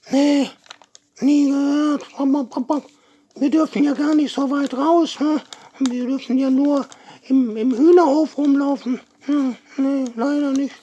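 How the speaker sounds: high hen voice with clucks; chatty puppet hen; Peggy. Pretend.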